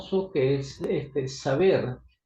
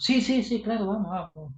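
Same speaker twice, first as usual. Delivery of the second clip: higher, eager quick agreement; eager yes; 6 semitones higher than usual. Retold or imitated. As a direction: jump at the offer. Young men eagerly accept prayer. (un sí entusiasta, más agudo)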